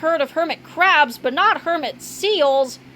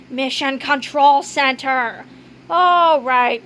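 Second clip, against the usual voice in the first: ordinary delivery, nothing marked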